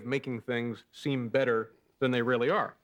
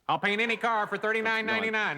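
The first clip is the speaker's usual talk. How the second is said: ad announcer voice; 9 semitones higher than usual. Retold or imitated. Quoting car ad slogan.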